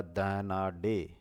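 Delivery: धनाढ्य drawn out softly, sound by sound; quiet careful spelling; slow spelling out. Shown as a demonstration. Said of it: "धनाढ्य ऐसे लिखा जाता है".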